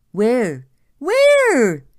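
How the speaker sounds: very high, loud frantic yell; panicked yell. A narrator's reading. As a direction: shout it in a panic, pitch flying up. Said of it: "yelled Plank".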